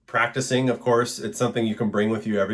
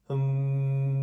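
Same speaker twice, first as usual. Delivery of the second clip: long, steady hum on one higher note; sustained hum; 6 semitones higher than usual. Shown as a demonstration. Teacher shows students how to breathe, steady demonstration hum. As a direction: hum one held note through the nose. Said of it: "so as I'm humming".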